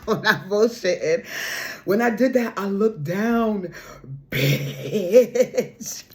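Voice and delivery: loud, high laughing talk; laughing excitedly. Real, not acted. Reader laughing loudly to viewers about the card she pulled.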